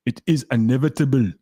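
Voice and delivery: hammed-up Arnold-style Austrian accent on a film quote. Streamer quotes a film line to chat in a bad Arnold Schwarzenegger accent. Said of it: "successfully doing an Arnold".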